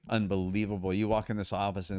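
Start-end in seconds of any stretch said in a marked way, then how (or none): none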